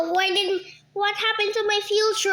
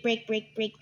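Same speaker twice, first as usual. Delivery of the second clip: ordinary talk, nothing marked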